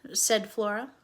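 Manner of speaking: plain reading voice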